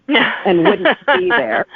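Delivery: slow, chuckling through the words; laughing. Real, not acted. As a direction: laugh it out slowly, amused. Guest jokes about invented cabin.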